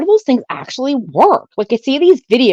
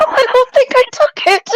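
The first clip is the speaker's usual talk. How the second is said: laughing; 12 semitones higher than usual. Retold or imitated. Retold pill confusion, laughing.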